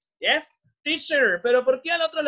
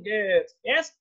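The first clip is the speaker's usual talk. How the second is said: normal talking voice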